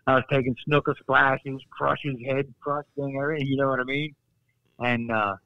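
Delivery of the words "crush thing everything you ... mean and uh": ordinary talk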